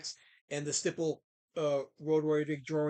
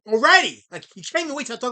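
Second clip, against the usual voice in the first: loud, high exasperated cry; exasperated outburst; 6 semitones higher, 10 dB louder than usual. Real, not acted. Streamer exasperated that chat heckles already, loud. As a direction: throw up your hands vocally.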